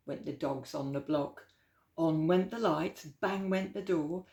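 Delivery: put-on lively, rhythmic read-aloud for kids; storybook reading. A narrator's reading. Storyteller reading Slinky Malinky aloud to children, lilting and playful. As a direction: read the rhyme with bounce and relish.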